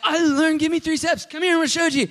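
bursting to show off the new song; high, loud, eager call to the friends; excited boast. Retold or imitated.

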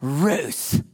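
Ruth dropped flat and falling, weary disappointment; shaming. Shown as a demonstration. Parent scolding a repeat offender, flat disappointed sigh. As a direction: let Ruth sink with disapproval. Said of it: "in a shaming way".

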